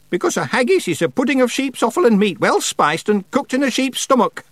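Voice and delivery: high witch voice, sharply instructive. A narrator's reading. Witch corrects Feegle about haggis, sharp. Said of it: "said Miss Treason sharply".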